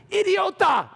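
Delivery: put-on Spanish accent. A driver yelling back in a Spanish accent. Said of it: "in a Spanish accent".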